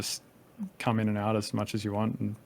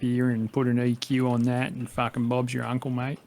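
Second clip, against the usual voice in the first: hammed-up broad Australian YouTube tutorial voice; broad bogan Australian. Host mocking his old tutorials in broad Australian accent. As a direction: lay the ocker Australian on thick, mocking his old self. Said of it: "I sound like a fucking bogan".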